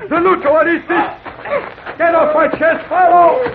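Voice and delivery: high, loud pinned-down shout; angry yell. Acted. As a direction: roar at him to get off. Carlos knocked down, yelling.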